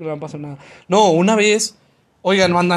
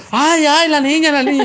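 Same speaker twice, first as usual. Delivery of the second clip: high, laughing imitation of panicky bystanders; mocking fretful onlookers; 9 semitones higher, 4 dB louder than usual. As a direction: ham up the useless crowd going ay ay. Onlookers fretting, mocked. (imitación burlona y aguda de mirones asustados)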